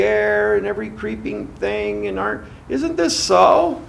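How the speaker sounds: high, loud mocking tempter; serpent tempting. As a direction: needle them like the sneering serpent. Preacher voicing the serpent.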